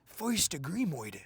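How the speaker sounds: soft, mock Italian-Chicago mobster accent; Colin Farrell's Penguin. Mocking the Penguin's merged accent, fake Italian-Chicago impression. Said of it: "It's fake Italian, fake Chicago".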